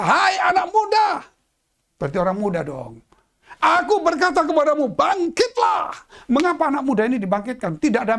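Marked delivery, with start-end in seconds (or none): 0.0-1.2 high ringing call to the dead youth; a powerful summons from Jesus; commanding shout. Acted. Jesus calls the young man. 3.6-5.9 Jesus raising the dead; commanding call. Acted.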